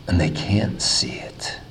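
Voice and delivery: a whispered lament. Real, not acted. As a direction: breathe it out sadly. Sermon's tragic low point.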